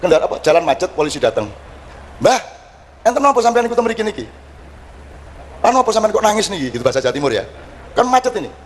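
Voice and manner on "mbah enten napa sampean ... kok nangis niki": lightly voiced policeman, higher-pitched; a policeman's puzzled question to an old man; raised-pitch polite inquiry. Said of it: "Polisi kan mau".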